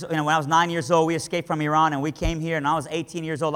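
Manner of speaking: ordinary talk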